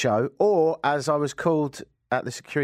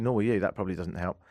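usual speaking voice

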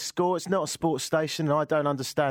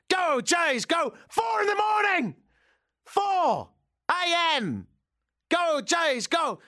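imitating a late-night shouter; slower than usual. Neighbour outside at four a.m., mock drunken shouting. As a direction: bellow it like a drunk fan in the street. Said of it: "someone was shouting".

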